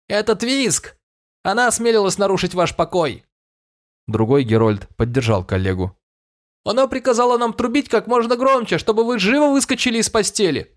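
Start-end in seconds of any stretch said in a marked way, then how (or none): 0.1-3.2 high calling herald voice; royal herald. A narrator's reading. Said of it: "прокричал". 6.6-10.7 put-on very high young herald voice; high, eager herald tattling; royal herald. A narrator's reading. Second herald backs him up.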